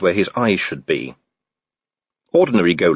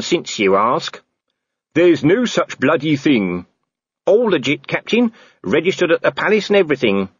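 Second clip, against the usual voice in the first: higher, smug corporal voice; cocky Nobby; 6 semitones higher than usual. Acted. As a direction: lord it over the captain. Nobbs voiced in story.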